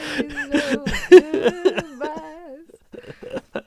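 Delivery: very slow, loud stretched words; drawn-out laughing. Acted.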